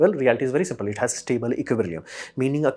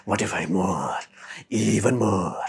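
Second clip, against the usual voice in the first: greedy lion voice; 5 semitones lower, slower than usual. Retold or imitated.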